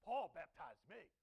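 loud, slow, proud brag; boastful claim. Retold or imitated. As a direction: announce it like a badge of honour. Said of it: "'Cause then you'll be like".

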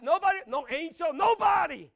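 loud, high shout; preaching shout. Acted. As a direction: cry it out to the room.

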